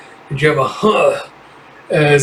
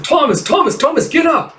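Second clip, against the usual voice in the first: much higher frantic calls to get up; desperate shouting; 13 semitones higher than usual. Acted. A teammate begging Thomas to get up, high desperate shout. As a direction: scream at the fallen friend. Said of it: "he's like".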